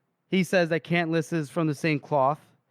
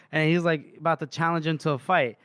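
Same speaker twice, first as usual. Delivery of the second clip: usual speaking voice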